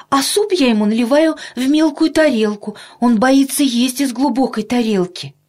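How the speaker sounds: high, plaintive mother's voice; embarrassed mother. A narrator's reading. Mother confessing Petya's many fears to the doctor, embarrassed. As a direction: explain apologetically, pitched well up. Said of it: "опять засмущалась мама".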